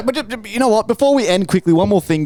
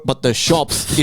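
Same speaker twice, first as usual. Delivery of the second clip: usual speaking voice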